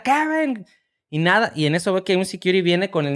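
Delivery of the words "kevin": calling out. Retold or imitated. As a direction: shout for the lost son, mock urgent. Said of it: "Yo empecé a gritar". (llamando al niño, grito más agudo)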